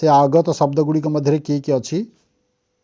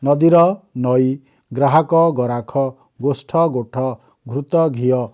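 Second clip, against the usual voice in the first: slow dictation. Shown as a demonstration. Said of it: "ଏଇଟା ହେଲା ତଦ୍ଭବ".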